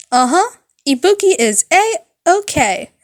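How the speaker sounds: put-on high, loud bubbly girl voice; high loud cheerful thanks; chipper rock girl. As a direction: toss it off brightly like a rock star. Ibuki gives thumbs up.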